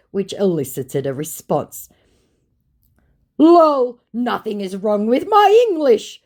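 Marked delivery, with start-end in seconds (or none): 3.4-6.2 high, loud mock protest; indignant exclamation. Scammer protesting to the host, mock indignant. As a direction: read it out as an over-punctuated denial. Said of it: "Four exclamation points".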